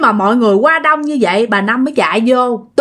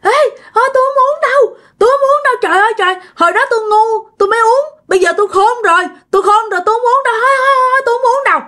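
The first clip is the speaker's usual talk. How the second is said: high-pitched wailing refusal, reenacted; retold screaming; 12 semitones higher, much faster than usual. Possessed mother refusing the poison, retold high wailing outcry. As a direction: act out a spirit crying out in protest. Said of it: "mẹ lại la làng lên".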